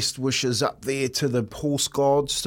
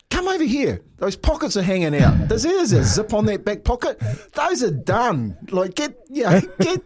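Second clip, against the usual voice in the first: hammed-up fussing partner, pitched way up; mock nagging wife; 7 semitones higher than usual. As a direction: partner nagging about pockets, mock high voice. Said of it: "she's going".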